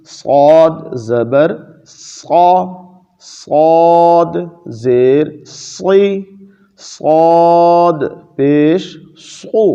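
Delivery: صا, صی, صو drawn out slowly with a thick, heavy saad; heavy saad sounds. Shown as a demonstration. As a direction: stretch each syllable of صاد زبر صا, make the saad full and heavy. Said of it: "صاد کی آواز موٹی آئی".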